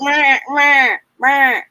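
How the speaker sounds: high squawky penguin bleats. Pretend. Host bleating at the audience in a penguin voice. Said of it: "My penguin voice".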